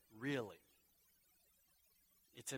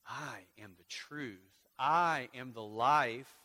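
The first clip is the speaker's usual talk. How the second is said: each I am drawn out slowly; solemn, slow proclamation; slow emphatic; 7 dB louder, much slower than usual. Acted. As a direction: lay weight on every I am. Slow emphatic reading of the I-am sayings.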